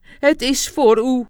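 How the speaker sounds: loud, deliberate entreaty in character; solemnly entreating. A narrator's reading. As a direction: press it on her as if swearing an oath. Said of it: "zei hij op bezwerende toon".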